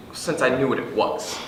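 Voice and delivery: normal talking voice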